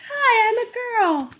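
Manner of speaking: mock girl voice. Mocking the audiobook reader doing a girl. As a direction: go way up and play the audiobook's girly voice. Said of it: "like, go up".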